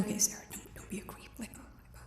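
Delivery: hushed self-scolding aside; barely voiced, urging herself; whispering.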